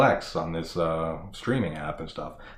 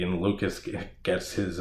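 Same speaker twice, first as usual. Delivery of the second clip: ordinary talk, nothing marked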